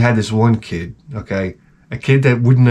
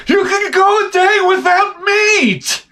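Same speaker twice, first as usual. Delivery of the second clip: high, slow indignant shriek; scolding wife; slower than usual. Retold or imitated. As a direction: scold him in a shrill voice.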